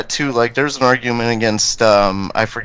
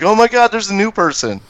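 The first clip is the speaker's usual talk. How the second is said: higher-pitched imitation of excited churchgoers; mock amazement; 6 semitones higher than usual. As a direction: gush in pretend surprise. Imitates congregation noticing a newcomer.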